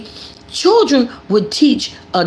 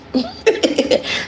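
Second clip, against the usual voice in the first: laugh; 8 semitones higher, 3 dB quieter than usual. Real, not acted. Laughs after story.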